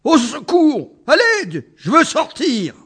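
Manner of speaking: high, loud screams for help; panicked screaming. Acted. Elephant clings to the chandelier, screaming in panic. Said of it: "s'entendit hurler".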